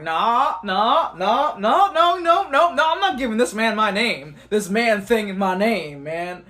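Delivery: high, loud run of refusals; re-enacted incredulous no, rising then easing off; emphatic refusal. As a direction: refuse loudly, pitch climbing with each repeat. Acting out refusing a stranger.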